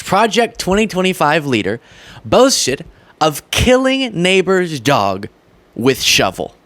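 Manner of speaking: usual speaking voice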